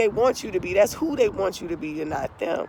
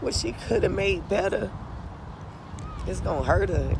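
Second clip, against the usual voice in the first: speaking through tears; real crying; 9 dB louder than usual. Real, not acted. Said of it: "that's why I'm crying".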